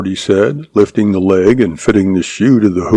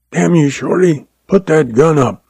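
higher-pitched angry order, not shouted; savage; 7 semitones higher than usual. A narrator's reading. Guard grappling Shorty for his gun, savage. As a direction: raise the pitch with contained fury. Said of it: "Cac said savagely".

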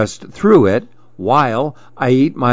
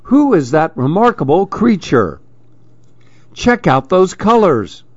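amazed; 6 semitones higher than usual. A narrator's reading. Animals marvel at him.

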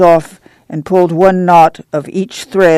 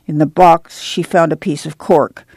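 plain reading voice

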